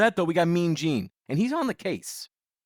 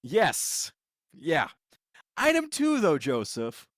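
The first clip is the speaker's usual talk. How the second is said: ordinary talk, nothing marked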